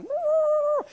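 high, loud trumpeting elephant whoop; elephant call. Retold or imitated. Preacher imitating safari elephants, high trumpeting call. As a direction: mimic an elephant trumpeting in the dark. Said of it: "you'd hear elephants going".

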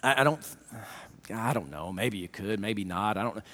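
low, soft, hesitant mumble; low soft unsure stammer; timid prayer. Retold or imitated. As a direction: pray like you doubt he can help. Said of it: "coming to God saying".

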